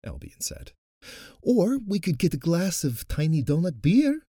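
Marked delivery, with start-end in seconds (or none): 1.4-4.3 high, loud eager suggestion; eager pitch. Acted. Natasha pitching a beer idea to the group, excited. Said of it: "Natasha pitched".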